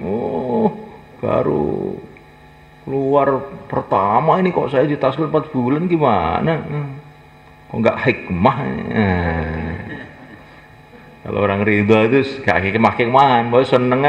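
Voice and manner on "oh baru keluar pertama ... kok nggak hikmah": imitating a grumbling member, pitched up; mock complaint. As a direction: whine like someone sulking about four months. Menirukan jamaah yang mengeluh. (menirukan orang cemberut mengeluh)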